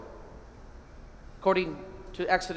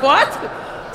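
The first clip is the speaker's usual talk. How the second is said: high breathy baffled "What?"; hammed-up bewildered "What?"; mock confusion; 9 semitones higher, 7 dB louder than usual. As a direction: play the stumped listener. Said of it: "You're like".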